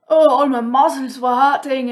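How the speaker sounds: retelling her sore muscles with a mock groan; mock complaint. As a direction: playfully exaggerate the aches. Retold moaning complaint.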